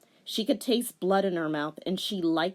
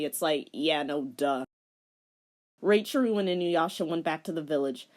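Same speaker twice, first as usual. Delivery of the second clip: plain reading voice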